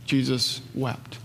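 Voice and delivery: soft, slow, hushed reading of "Jesus wept"; hushed and reverent. Acted. Preacher quoting the shortest verse, hushed and slow. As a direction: let "Jesus wept" fall quiet and slow.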